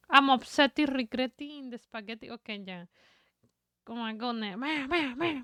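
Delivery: ordinary talk, nothing marked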